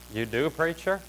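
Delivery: low, hushed aside; very soft, low-pitched query; quiet listener's question. Acted. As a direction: ask it softly and low, as a listener. Acts out a surprised listener's question.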